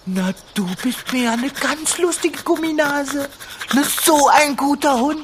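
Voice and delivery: high silly dog talk. Acted. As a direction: coo at a pet. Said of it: "Sonst würdest du auch mit mir so sprechen, so albern".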